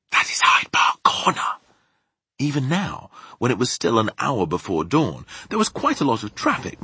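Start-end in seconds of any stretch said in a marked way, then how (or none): 0.1-1.5 emphatic stage whisper. Acted. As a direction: whisper the landmark's name with weight. Sophie whispering directions.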